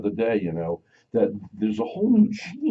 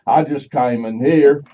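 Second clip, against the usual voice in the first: put-on big old country Texan voice; Texan accent; 5 semitones higher, 6 dB louder than usual. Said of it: "Mary doesn't like it when I do that accent".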